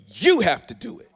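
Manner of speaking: YOU punched high and loud, the rest dropping away; forceful exclamation. Shown as a demonstration. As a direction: hit "You" hard, like an exclamation mark. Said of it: "put some force behind it".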